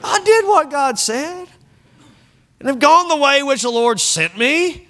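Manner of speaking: high whining self-defence; whiny voice. Retold or imitated. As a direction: whine out the excuse. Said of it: "You had to have a little whiny voice".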